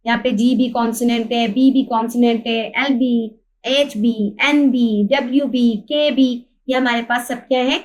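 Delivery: usual speaking voice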